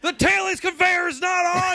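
high frantic yell; panicked shout. Pretend. Acting out the crew's panic.

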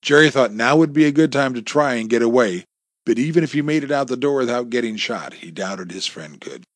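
plain reading voice

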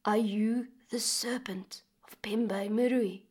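slow, soft, trembling whisper; frightened man whispers to the sleeping serpent; fearful whisper. Acted. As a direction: whisper it, afraid to wake the serpent. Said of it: "in almost a whisper".

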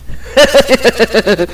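a pleased laugh after the win. Real, not acted. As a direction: let a real laugh out and trail off. Laughing after pinning brother.

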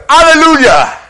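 high, very loud joyful shout; a hammed-up preacher's hallelujah; mock hallelujah shout. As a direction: blast it to drive people out of the room. Shouting to scare off visitors.

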